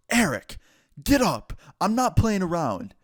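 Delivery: retold angry command, pitch jumping up on each order; angry teacher. Teacher ordering the sleeping student up, angry. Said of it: "pure calm to just pure anger".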